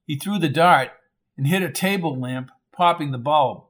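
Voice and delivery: usual speaking voice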